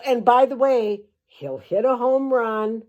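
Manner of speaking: normal talking voice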